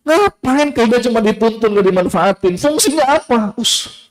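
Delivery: high, incredulous busybody; mocking netizen. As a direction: sneer up high in disbelief. (suara tinggi nyinyir tak percaya)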